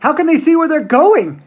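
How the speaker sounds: high, loud frightened shriek; girl shrieking in the dark tunnel. A narrator's reading. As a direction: scream it into the dark. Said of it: "shrieked Violet Beauregarde".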